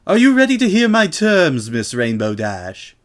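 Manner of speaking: smug magically amplified challenge; Miss Vinton voice. Acted. Miss Vinton addressing Rainbow before the crowd, haughty and amplified. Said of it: "made Miss Vitton's voice sound clearly through the courtyard".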